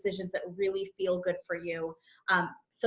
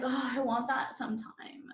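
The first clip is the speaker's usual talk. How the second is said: vague wishing; 7 dB quieter than usual. Shown as a demonstration. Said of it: "Not like".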